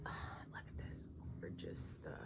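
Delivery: very soft, breathy whisper of delight; awed whisper. Real, not acted. Crafter showing off gorgeous yarn, hushed awed whisper. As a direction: marvel at it under your breath. Admiring yarn as she unboxes it.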